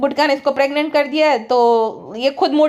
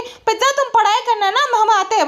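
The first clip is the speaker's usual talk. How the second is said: much higher, sugary mock voice; mimicking sweet talk; 8 semitones higher than usual. Vlogger imitating the woman telling Pizza to study, higher sugary voice. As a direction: ape her syrupy instructions. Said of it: "गुटके के साथ बोलती है".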